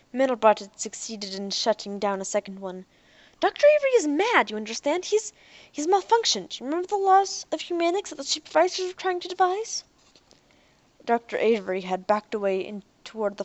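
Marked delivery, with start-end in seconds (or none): 3.4-9.8 high, fast and pressing; urgent plea. A narrator's reading. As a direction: Ariel urges the hunters in a high, pressing voice. Ariel pleads with hunters.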